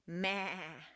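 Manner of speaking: drawn-out "maa" like a goat; goat bleat. Shown as a demonstration. Teacher shows the vowel by imitating a goat's bleat. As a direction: bleat one long, open "maa". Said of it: "the sound that a goat makes".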